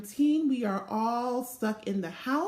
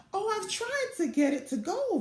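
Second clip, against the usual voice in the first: moaning; 7 semitones higher than usual. A narrator's reading. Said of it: "Velma moaned".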